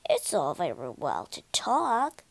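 low grumble; grumbling. A narrator's reading. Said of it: "Mole grumbled".

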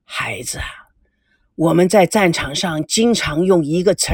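put-on low, measured elder lecturing a young man; stern elder. (低沉的长辈语气)

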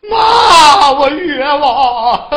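loud wailing, then slow, drawn-out sobbing; crying protest. Acted. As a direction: cry out the injustice to your mother. Son protests through tears.

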